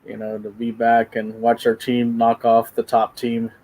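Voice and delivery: ordinary talk, nothing marked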